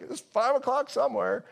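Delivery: high-pitched cheeky quip; joking disciple. Acted. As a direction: pipe up from the back as a disciple. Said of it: "one of the disciples was like".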